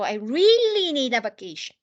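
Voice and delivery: "really" punched and held long; desperate REALLY. Shown as a demonstration. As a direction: stretch "really" as if desperate for a break. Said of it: "Like you're desperate for it".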